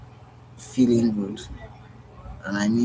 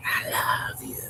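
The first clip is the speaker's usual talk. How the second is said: breathy whisper, close and intimate; whispered I love you; 3 dB quieter than usual. Pretend. Speaker whispering to the one they love, hushed whisper. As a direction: murmur it into a lover's ear.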